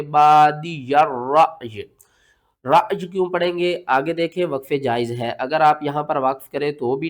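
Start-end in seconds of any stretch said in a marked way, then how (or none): 0.0-1.9 बादिया drawn out, रअयी cut to a catch and a still final y; recited with a pause-stop. Shown as a demonstration. Teacher showing the stop, रअयी recited with a jerk. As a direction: chant बादिया slowly, then stop sharply on रअयी. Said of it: "जब हम वक्फ करेंगे तो पढ़ेंगे".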